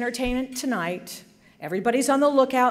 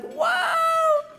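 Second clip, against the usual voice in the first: high amazed whoop; whoa. Retold or imitated. Hearing she is in the Hall of Fame, high surprised whoop. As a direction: exclaim in sheer surprise. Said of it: "I was like".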